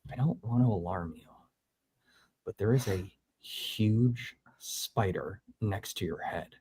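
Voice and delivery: low, soft, careful whisper-like warning; quietly telling her friend a huge spider is by his head; hushed calm warning. Retold or imitated. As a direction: break scary news gently and quietly. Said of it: "she goes".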